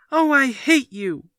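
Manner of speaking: put-on high, loud furious child's scream; screaming at father. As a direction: hurl it at your father. Boy screams at his father.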